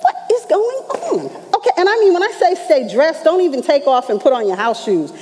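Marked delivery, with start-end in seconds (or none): 0.0-1.3 high, puzzled what-is-going-on; bewildered. Retold or imitated. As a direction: ask it like you can't believe it. Bewildered reaction to strange command.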